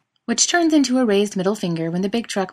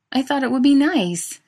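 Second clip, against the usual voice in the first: higher, louder protest; 8 semitones higher, 6 dB louder than usual. Acted. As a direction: defend yourself indignantly. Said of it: "Adam protests".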